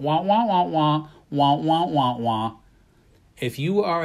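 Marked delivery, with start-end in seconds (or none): 0.0-2.6 trombone-like wah wah teacher voice; muffled wah wah talk. Retold or imitated. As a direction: mimic the Peanuts teacher's muffled drone. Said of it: "the teacher going".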